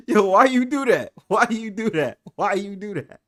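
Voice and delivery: high, loud, laughing plea that fades; cracking up, repeating the question. Real, not acted. Host laughing at friends' story, repeating why. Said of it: "I cannot stop laughing".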